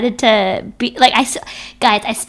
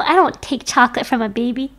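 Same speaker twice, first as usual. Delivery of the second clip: ordinary delivery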